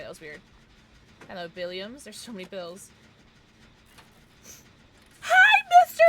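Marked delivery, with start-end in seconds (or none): 5.2-6.0 high loud greeting; excited squeal. Real, not acted. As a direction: squeal hello.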